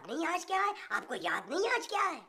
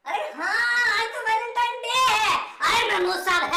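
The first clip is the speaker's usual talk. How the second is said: excited realization; 6 semitones higher, 9 dB louder than usual. Acted. Nabeel also realizes, excited.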